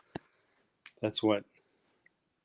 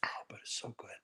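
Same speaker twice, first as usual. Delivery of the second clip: whispering; 2 semitones higher, 8 dB quieter, faster than usual. Real, not acted. Savoring a hot marshmallow.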